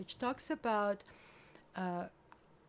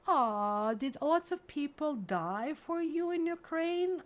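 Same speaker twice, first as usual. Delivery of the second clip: loud, high imitation of a gushing stranger; hammed-up pitying question, pitched up and loud; mock pity; 7 semitones higher, 6 dB louder, slower than usual. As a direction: overdo the sympathy, imitating a nosy passer-by. Voices nosy stranger's pitying question.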